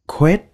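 "quét" said short and rising, like Vietnamese; first part "quét" on its own, rising; first syllable demo. Shown as a demonstration. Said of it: "Các bạn đọc là".

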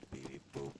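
robot beeps. Pretend. Player voices a robot.

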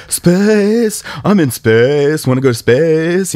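high, slow dopey voice; idiot voice. Retold or imitated. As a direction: drag it out like a dopey kid. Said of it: "in this totally, like, idiot voice".

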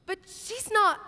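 ordinary talk, nothing marked

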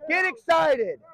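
very high, whooping coach's shout; excited celebratory yell. Real, not acted. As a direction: fire the team up after scoring.

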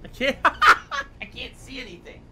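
high, slow words broken by laughter; laughing helplessly. Real, not acted. Player blinded by teary eyes mid-fight, laughing helplessly. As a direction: break into laughter mid-sentence. Laughing at being unable to see.

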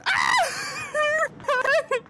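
very high crying shriek of laughter; scream-laugh in pure panic; panicked laughing scream. Real, not acted. Scared in horror game.

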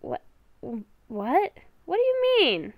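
usual speaking voice

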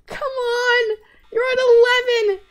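pleading, strained and high; will the timer down, frantic; desperate high urging. Real, not acted.

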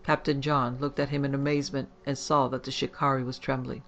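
plain reading voice

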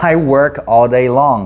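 "I work all day long" said very slowly and clearly; slow teacher demo sentence; slow model sentence. Shown as a demonstration. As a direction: spell it out word by word for the class. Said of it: "ใส่เข้าไปอีกตัวหนึ่ง long".

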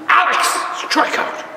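very high, rasping mock yell; hammed-up screech at a ballplayer; imitated intensified heckling. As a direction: heckle the batter as a frantic mother. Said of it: "my mother intensified when he got up".

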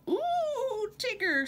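high, excited squeal; little joey squeals as they bounce up. A narrator's reading. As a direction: squeal with delight. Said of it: "squealed Roo".

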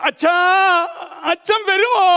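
high, loud call to a distant father; calling out far. A narrator's reading. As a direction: call out across the sea, longing. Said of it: "ദൂരേക്ക് നോക്കിക്കൊണ്ട് വിളിച്ചു".

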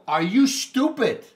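retold furious telling-off, shouted high and loud; heated rant. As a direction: tear into the IFL guy at full volume. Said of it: "I go".

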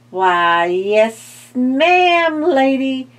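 loud, high crackling skeleton voice; crackly skeleton voice. A narrator's reading. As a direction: crackle it out loudly like bones. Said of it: "the skeleton crackled loudly".

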